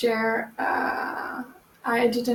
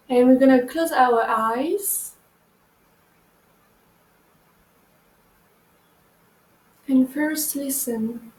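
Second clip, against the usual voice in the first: guided meditation. Acted. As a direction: ease them into breathing gently. Leads a meditation.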